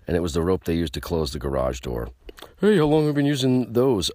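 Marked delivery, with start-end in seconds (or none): 2.6-4.1 higher, louder put-on dumb voice; hammed-up bewildered mechanic voice; mock NASCAR crew guy. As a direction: play a clueless garage worker. Acting NASCAR crew in anecdote.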